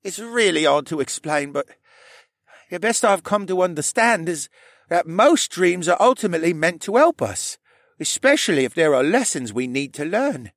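high-pitched voice for Rylan, earnest explanation; Rylan explaining. Acted. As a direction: explain it in a high, accented character voice.

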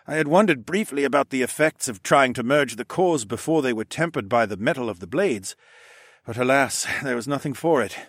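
lively, lilting figure of a battered show-off; Horace, theatrically explaining. A narrator's reading. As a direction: explain it with dramatic resignation.

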